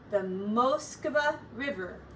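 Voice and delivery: ordinary delivery, nothing marked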